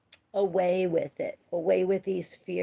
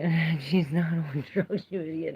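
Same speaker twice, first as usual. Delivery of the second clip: ordinary delivery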